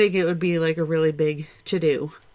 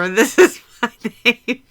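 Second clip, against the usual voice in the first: louder, amused mimicking; being tickled; 4 semitones higher, 8 dB louder than usual. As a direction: laugh it out as a tease. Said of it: "that just tickled me".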